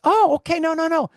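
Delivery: high, loud backpedaling; surprised reaction. Retold or imitated. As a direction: imitate a woman hastily correcting herself. Retold woman reacting to his answer.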